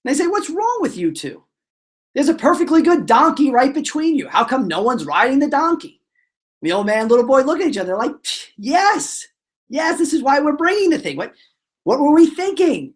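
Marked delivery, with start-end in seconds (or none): 0.3-6.0 high-pitched mock ridicule, no actual laugh; imitated jeering onlookers. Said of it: "They start pointing and laughing". 8.6-12.9 high-pitched, eager mock agreement; hammed-up eureka moment; acted realization. Acted sudden realization of a mistake.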